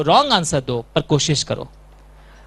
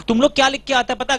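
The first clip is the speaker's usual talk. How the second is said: normal talking voice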